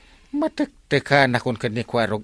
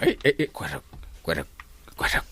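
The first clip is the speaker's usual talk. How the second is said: hushed calling; 9 semitones lower, 10 dB quieter than usual. Acted. Calling nephew discreetly.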